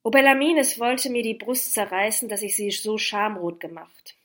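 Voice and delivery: loud, impassioned outburst; anguished cry. A narrator's reading. Lover tormented at having shamed her, anguished cry. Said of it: "rief ich".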